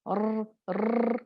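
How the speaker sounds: "र, र" with an exaggerated trill; overrolled ra. Shown as a demonstration. Teacher demonstrating the wrong overrolled "र". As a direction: roll "र" too long on purpose. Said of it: "ऐसे नहीं पढ़ना".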